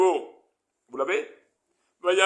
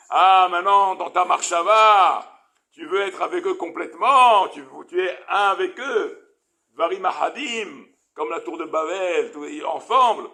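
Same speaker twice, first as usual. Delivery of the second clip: angry divine rebuke; 6 dB louder than usual. Acted. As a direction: thunder at him as an angry God. God rebukes Bilam, raised voice. (Dieu en colère, d'une voix forte et lente)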